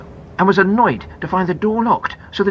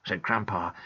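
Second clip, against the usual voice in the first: ordinary delivery, nothing marked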